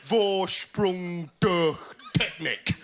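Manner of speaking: slow, lightly posh mock German slogan; pretentious upper-class prat. Comic sneering at a car ad slogan, mock posh German. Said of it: "as you say if you're a pretentious upper-class prat".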